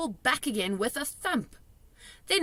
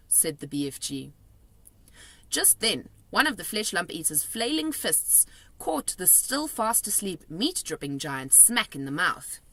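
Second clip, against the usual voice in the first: ordinary delivery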